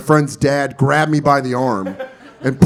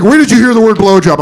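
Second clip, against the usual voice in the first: loud, high-pitched questioning; urgent demand; 7 semitones higher, 9 dB louder than usual. Retold or imitated. Friend's dad grills an eight-year-old, urgent and loud. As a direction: demand it like an alarmed parent.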